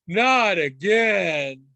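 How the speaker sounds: slow, drawn-out laughing groan; weary groan. Retold or imitated. Kid groaning at the fingers story, laughing exasperation.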